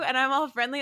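normal talking voice

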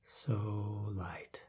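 hushed trailing whisper; soft spa whisper. Acted. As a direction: breathe it out softly. Meditation relaxation deepens.

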